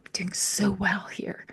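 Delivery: whispering smugly to herself; a whispered pat on her own back; whispered thought. Retold or imitated. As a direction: breathe it out like a secret pleased thought. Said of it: "I thought".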